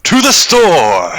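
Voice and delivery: loud slow shout. Acted. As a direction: belt it out. Shouted exclamation.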